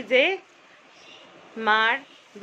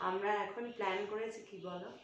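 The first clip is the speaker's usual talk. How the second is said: conspiratorial hush, planning a surprise; hushed secret talk; 3 semitones lower, 11 dB quieter than usual. Real, not acted. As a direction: keep it very soft so mom can't hear. Hiding surprise gift from mother. (মায়ের কাছে লুকিয়ে, খুব নিচু গলায় ফিসফিসে কথা)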